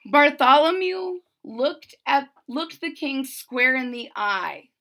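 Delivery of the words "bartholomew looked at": ordinary delivery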